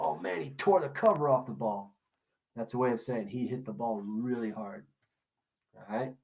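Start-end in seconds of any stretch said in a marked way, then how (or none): 0.0-1.9 higher, excited baseball fan exclaiming. Retold or imitated. Teacher voicing a fan about a big hit, excited. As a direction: cheer it like a fan in the stands. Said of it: "that's a saying in baseball".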